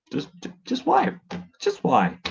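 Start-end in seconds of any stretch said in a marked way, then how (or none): 0.1-1.1 high pleading whine; squeaky exasperated why. Real, not acted.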